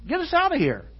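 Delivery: high, loud acted plea; crying out. Israelites praying to God for rescue, acted high cry. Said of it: "praying and crying out to God".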